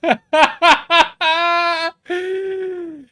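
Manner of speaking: loud high burst of real laughter; high cackle of disbelief; laughing. Real, not acted. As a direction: explode laughing at the clip. Laughing at the clip.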